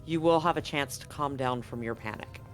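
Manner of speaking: ordinary talk, nothing marked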